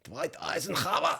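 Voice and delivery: mock German accent; hammed-up German pronunciation of a name. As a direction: put on a playful German accent. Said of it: "it's all right to do the accent".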